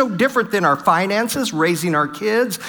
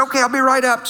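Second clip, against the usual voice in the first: wife's reply; 8 semitones higher, faster than usual. Retold or imitated. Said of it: "And she said".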